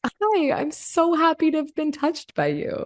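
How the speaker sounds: high, slow, gushing greeting; delighted imitation. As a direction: imitate someone blissfully happy to see you. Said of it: "he literally looked at me like".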